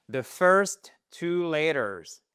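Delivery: "The first two letters" said very slowly, each word clear; slow teaching demo. Shown as a demonstration. Teacher slowly modelling the phrase for students. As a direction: spell it out slowly for the class. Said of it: "Les deux premières lettres en anglais".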